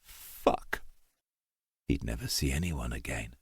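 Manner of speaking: breathless whispered fuck; whispered curse. A narrator's reading. Dying climber curses himself under his breath, whispered. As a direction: let it slip out on a breath.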